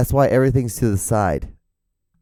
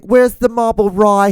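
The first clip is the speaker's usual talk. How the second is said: higher, louder, slow mocking New York voice; Michael Savage impression; 11 semitones higher, 5 dB louder than usual. Podcaster mocking radio host's talk, much higher voice. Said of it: "he just talks like".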